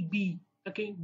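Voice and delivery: ordinary talk, nothing marked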